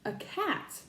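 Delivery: ordinary delivery, nothing marked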